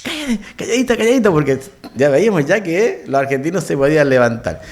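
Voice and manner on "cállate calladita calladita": urgent hushing. Retold or imitated. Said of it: "y todos nosotros".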